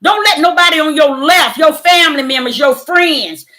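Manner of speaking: loud, high, rousing exhortation; urging the faithful, shouting to stand firm; full-force preaching. Acted. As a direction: preach it hard to the flock. Preaching to her viewers.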